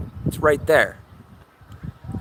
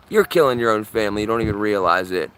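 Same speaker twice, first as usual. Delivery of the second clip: ordinary talk, nothing marked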